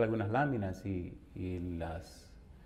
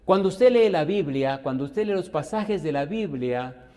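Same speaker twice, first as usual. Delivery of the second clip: loud, higher cry; preaching burst; 4 semitones higher, 6 dB louder than usual. Real, not acted. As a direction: hit it hard. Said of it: "preaching burst".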